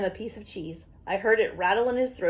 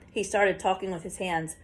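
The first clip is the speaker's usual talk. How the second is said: plain reading voice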